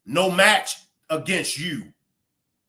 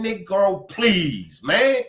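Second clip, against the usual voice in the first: usual speaking voice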